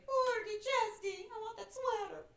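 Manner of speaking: very high, slow crying plea; sobbing plea. Shown as a demonstration. Teacher begging for the sweater in a high slow sob. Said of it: "we're just gonna sob".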